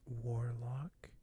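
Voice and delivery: normal talking voice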